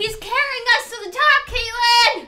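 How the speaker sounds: high, loud rallying yell; excited shout. Real, not acted. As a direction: cheer it out to a friend.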